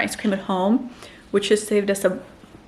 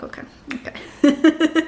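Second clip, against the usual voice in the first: weary okay; 5 semitones higher, slower than usual. Retold or imitated. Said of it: "so I'm like".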